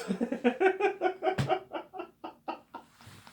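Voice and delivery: loud high cackle; high laugh. Real, not acted. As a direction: burst out laughing.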